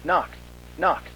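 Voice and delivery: plain reading voice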